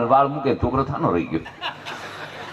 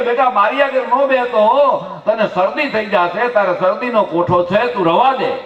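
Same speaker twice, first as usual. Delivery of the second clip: caring warning; 7 semitones higher than usual. Retold or imitated. Mother warns son travelling.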